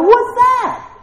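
high, explosive cry of disbelief; incredulous shout. Acted. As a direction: yell it as an acted startled reaction. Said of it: "It's like".

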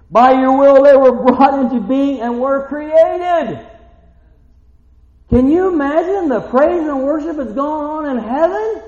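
loud, high, slow hammering praise; full-force preaching. Acted. Preacher praising God before the congregation, full force. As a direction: preach it to the rafters.

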